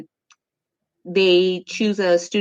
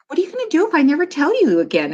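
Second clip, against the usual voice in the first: higher, fast, playfully over the top; mock dramatic mom; 8 semitones higher, much faster than usual. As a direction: tease them with fake drama. Mom teasing her kids.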